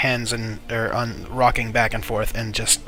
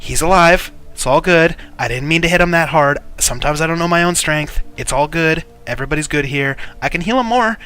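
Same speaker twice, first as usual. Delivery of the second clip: higher-pitched, hasty smoothing-over; nervous reassurance; 5 semitones higher, 3 dB louder than usual. Acted. Aris reassuring the guard captain after hitting his man, nervously high. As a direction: insist all is well, flustered.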